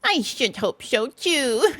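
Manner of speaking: slow, self-satisfied little Mole; confident Mole. A narrator's reading. Mole reassuring Rat, confident. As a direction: answer cockily, drawing it out. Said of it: "said the Mole confidently".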